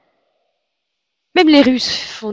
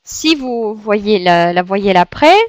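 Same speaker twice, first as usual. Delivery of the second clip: normal talking voice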